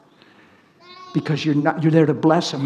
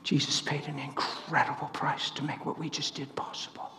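much softer, whispered; 10 dB quieter than usual. Retold or imitated. Telling the woman what Jesus paid, whispered. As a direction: hush it out tenderly. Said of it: "soft word to the prayed-for woman".

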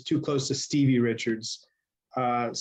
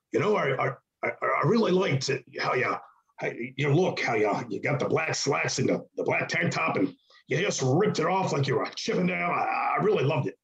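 halting, swooping boss impression; put-on boss voice, stretched and stumbling; Vince impression. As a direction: stammer it out as the excitable boss. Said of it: "That's a great Vince impression".

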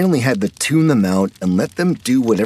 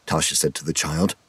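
plain reading voice

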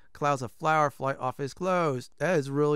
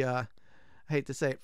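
usual speaking voice

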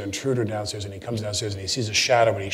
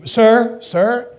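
much higher, louder pleading woman's voice; woman calling out; 11 semitones higher, 6 dB louder than usual. Retold or imitated. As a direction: chase him down, calling sir urgently.

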